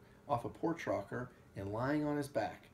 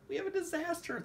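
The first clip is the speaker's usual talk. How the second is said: high, breathless alarm. A narrator's reading. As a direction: gasp out the news as if just run in. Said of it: "they said breathlessly".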